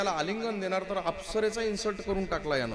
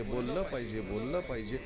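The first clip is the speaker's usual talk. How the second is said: बोललं पाहिजे repeated lower and softer like an echo; 4 semitones lower, 4 dB quieter than usual. Shown as a demonstration. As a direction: let बोललं पाहिजे ring back and fade. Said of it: "ती फक्त शेवटचा शब्द उच्चारायची". (बोललं पाहिजे चा खालच्या आवाजात विरणारा प्रतिध्वनी)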